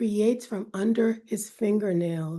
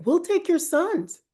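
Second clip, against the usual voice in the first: much higher, eager threat; menacing demand; 9 semitones higher than usual. Acted. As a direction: claim the sons greedily. Underworld beings demand.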